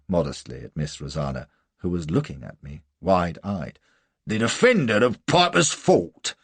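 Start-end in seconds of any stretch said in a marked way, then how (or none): 4.2-6.4 loud, high-pitched exclamation; sergeant's cry. A narrator's reading. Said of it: "cries the sergeant".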